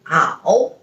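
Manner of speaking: ow dipped low and up; third tone ao. Shown as a demonstration. Teacher modelling third tone ao. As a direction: dip ow down then up. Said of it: "Third tone".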